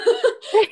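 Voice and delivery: high, loud laugh; real laugh; burst of laughter. Real, not acted. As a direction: laugh out at the memory. Laughing at the prank.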